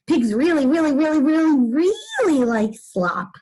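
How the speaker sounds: growing emphatic insistence; building emphasis. Shown as a demonstration. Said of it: "I can do that with my body".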